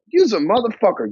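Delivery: playful, loosely swung delivery; friendly tone. Shown as a demonstration. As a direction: say "Use a motherfucker" as a friendly jab. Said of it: "See the difference? That's tonality".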